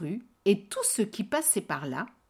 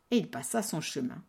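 plain reading voice

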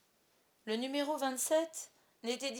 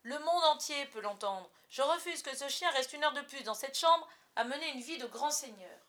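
louder, defiant declaration; 5 semitones higher, 6 dB louder than usual. A narrator's reading. Father defying his wife, loud and defiant. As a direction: refuse flatly and proudly. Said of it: "sur le ton du défi".

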